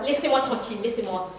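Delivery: low, soft plea to be left alone; withdraw, voice sinking away; quiet and dejected; sullen refusal. Acted. Upset woman refuses to talk.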